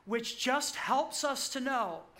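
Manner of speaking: ordinary talk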